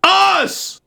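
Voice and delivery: shouted middle name. Pretend.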